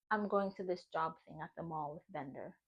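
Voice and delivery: mimicking the boyfriend, low and soft; low mock boyfriend voice. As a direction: quote him flat and quiet.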